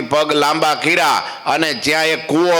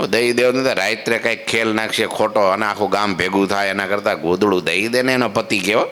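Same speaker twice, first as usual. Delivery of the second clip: normal talking voice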